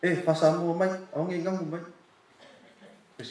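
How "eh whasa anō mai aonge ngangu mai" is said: higher, louder grandma scolding in Samoan; imitating Grandma. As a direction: playfully mimic grandma demanding to know the talk. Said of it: "She always said".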